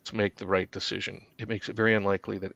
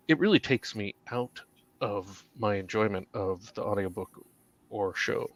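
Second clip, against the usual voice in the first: normal talking voice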